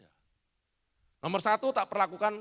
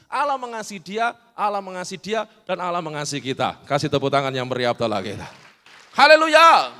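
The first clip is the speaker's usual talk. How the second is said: loud, building praise shout; preaching at full force; 15 dB louder than usual. Real, not acted. Preacher stirring the congregation into applause. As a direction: rouse the church to applause.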